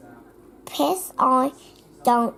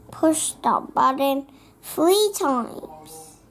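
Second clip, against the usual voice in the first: ordinary delivery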